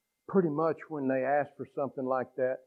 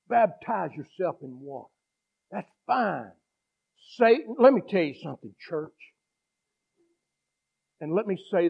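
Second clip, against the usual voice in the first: loud, driving revival preaching; preaching at full force; 3 semitones higher, 4 dB louder than usual. Acted. Preacher pressing the congregation, loud and driving. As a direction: preach it hard to the church.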